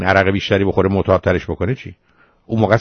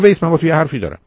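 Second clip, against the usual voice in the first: retold shout; 7 semitones higher, faster than usual. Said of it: "این مرد فریاد زد".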